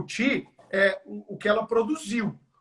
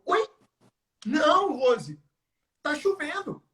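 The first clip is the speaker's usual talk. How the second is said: incredulous; 6 semitones higher, slower than usual. Retold or imitated.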